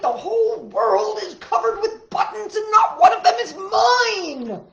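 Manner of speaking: high, loud furious scream. Acted. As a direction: scream it out in a tantrum. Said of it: "he screamed".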